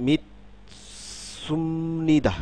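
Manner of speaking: মিতসুমনিদা drawn out syllable by syllable; tensed s demonstration. Shown as a demonstration. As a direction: break after মিত, then stretch সুমনিদা. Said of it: "এই স পরিবর্তিত হয়ে গেল".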